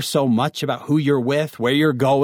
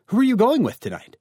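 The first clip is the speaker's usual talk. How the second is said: questioning parent; 9 semitones higher than usual. Acted. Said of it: "Your parents might ask you".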